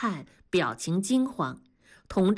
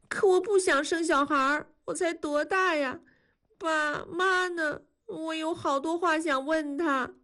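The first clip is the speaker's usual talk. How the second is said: put-on tearful girl; 8 semitones higher than usual. Frightened girl after first period.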